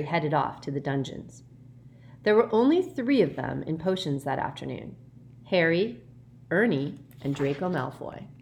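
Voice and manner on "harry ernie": usual speaking voice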